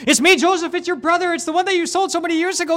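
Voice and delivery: very high, strained, choking voice, fast in the middle; tearful reveal. Retold or imitated. Joseph reveals himself to his brothers, high and tearful. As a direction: push the words out through tears.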